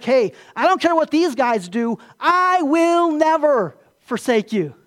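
loud, drawn-out emphatic vow; acted-out adamant pledge. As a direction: swear it insistently, stretching the words out. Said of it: "Peter's emphatic".

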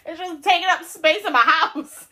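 loud, high, laughing as she talks; cracked up and exclaiming; laughing complaint. Real, not acted. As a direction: complain loudly through real laughter. Laughing over her unwanted booty.

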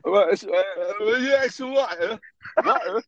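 mock the English accent, drawing it out high; squeaky-high English accent send-up. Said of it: "Now I'm mocking it".

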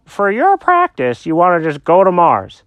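plain reading voice

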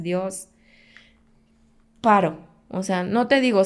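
normal talking voice

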